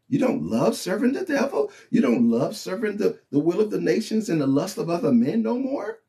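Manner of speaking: incredulous sinner. Retold or imitated. Unbelievers mocking the faithful, high and incredulous. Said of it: "they look at us like".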